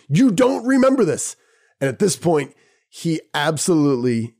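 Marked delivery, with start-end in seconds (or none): 0.1-1.4 incredulous. Retold or imitated. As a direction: pitch up in astonished disbelief. Incredulous at his forgetting.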